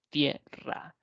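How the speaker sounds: «Tierra» repeated in two beats, tie - rra; sílaba por sílaba. Shown as a demonstration. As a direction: break «Tierra» after tie, then rra. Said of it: "aquellas formadas por dos sílabas". («Tierra» pausado en dos sílabas: tie - rra)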